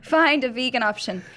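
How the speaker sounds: reading a recipe in a put-on Irish accent; Irish lilt, reading aloud. Shown as a demonstration. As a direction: attempt a Cork accent. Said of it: "your Irish accent".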